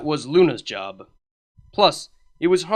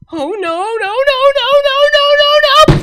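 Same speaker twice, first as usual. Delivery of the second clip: rising, shrieking cries of no, much higher and louder; panicked scream; 11 dB louder, faster than usual. Acted. Cadence plummeting to the ground, shrieking in panic. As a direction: scream all the way down as you fall.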